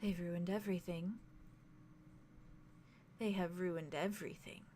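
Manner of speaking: softly despairing, a touch of grief. Acted. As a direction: breathe the words out, heartbroken. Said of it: "she says very softly".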